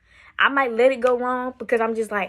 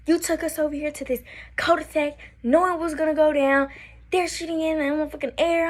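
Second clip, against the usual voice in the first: high-pitched scolding, not a full shout; mock yell of accusation, pitched up; retold angry yelling; 6 semitones higher than usual. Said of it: "crying, I'm yelling at him".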